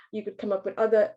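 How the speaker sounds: normal talking voice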